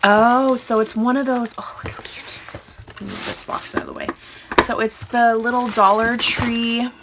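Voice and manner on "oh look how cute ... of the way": whisper. Real, not acted. Whispering while moving box.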